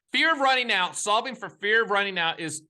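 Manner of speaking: high, forceful closing point; emphatic insistence. Real, not acted. Drives home his closing point.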